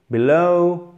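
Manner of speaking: slow teacher's demo of be-LOOW; drawn-out diphthong ending. Shown as a demonstration. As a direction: drag out the end of below as a double sound. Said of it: "vous faites traîner sur la fin".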